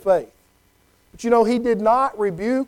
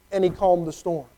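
ordinary talk

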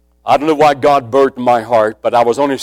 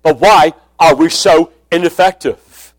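raised, louder preacher's call; a pulpit sermon pitched up and pushed loud; full-force preaching; 3 semitones higher, 5 dB louder than usual. Acted. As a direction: preach it out over the crowd. Preaching opener to the congregation.

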